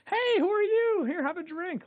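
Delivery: high, bubbly party mom offering drinks; chirpy hostess voice, way up high; imitating the cool mom at the party. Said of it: "one of those cool moms".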